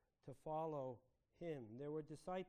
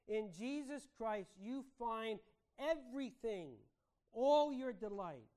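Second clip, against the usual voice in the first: raised, fervent sermon delivery; higher, emphatic preacher's appeal; impassioned preaching; 4 semitones higher, 5 dB louder than usual. Real, not acted. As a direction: press the question on the congregation, building to a loud peak.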